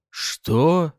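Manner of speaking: high, loud, drawn-out question; drawled disbelief. A narrator's reading. Husband taken aback by wife's outburst, drawled. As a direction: stretch it out, rising. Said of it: "протянул Арманов".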